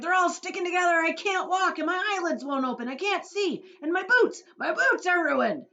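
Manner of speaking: high, loud outraged complaint; angry wailing. A narrator's reading. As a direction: rant in high-pitched fury. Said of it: "The centipede screamed with anger".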